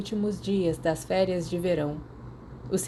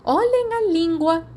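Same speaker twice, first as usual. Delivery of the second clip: higher, louder and slow motherly scold; 10 semitones higher, 6 dB louder, slower than usual. A narrator's reading. Mrs Potter scolding the two boys for swearing, loud and high. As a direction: snap at the boys to mind their language. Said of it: "a senhora Potter repreendeu os dois".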